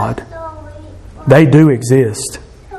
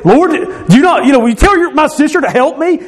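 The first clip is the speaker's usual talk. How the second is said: mock frustrated yell; 11 semitones higher than usual. Martha complaining to Jesus about her sister, high mock yell. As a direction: act out an exasperated plea, pitched up not shouted. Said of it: "She yelled at him".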